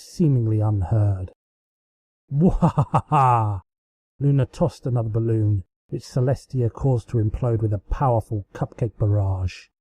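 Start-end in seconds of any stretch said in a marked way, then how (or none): 2.3-3.6 slow villain cackle; evil cackle. Acted. Luna cackling as she throws balloons.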